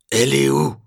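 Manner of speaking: loud, high thundering demand; thundered. A narrator's reading. Lieutenant thundering at Lupin, loud and high. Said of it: "tonne Sylvenair".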